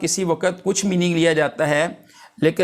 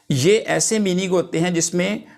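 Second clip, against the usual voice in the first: normal talking voice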